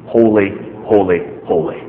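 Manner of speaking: HOLY, HOLY, HOLY low and spaced by pauses; emphatic repetition. Shown as a demonstration. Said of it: "They're emphasizing the holiness of God".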